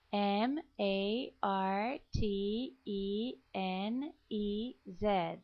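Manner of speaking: letters spelled slowly one by one; slow spelling. Shown as a demonstration. Teacher spelling a name for the class, slow. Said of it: "Martínez is".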